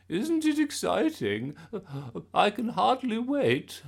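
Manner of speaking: high, loud gushing granny; excited Gran voice. A narrator's reading. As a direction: bubble over with glee.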